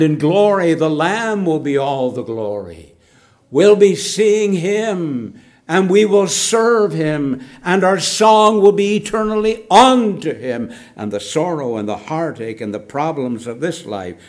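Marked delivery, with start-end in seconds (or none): 3.5-10.7 fervent preaching. Real, not acted. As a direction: lift it up in triumphant hope. Preaching of future glory.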